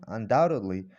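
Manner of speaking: usual speaking voice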